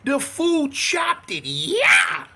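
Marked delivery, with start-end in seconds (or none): 1.4-2.2 loud mock battle cry; imitated chopping yell. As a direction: shout it like a sword strike.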